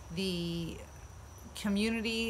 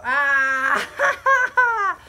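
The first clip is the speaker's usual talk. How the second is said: excited; 7 semitones higher, 10 dB louder than usual. Real, not acted. Opening the box, delighted scream.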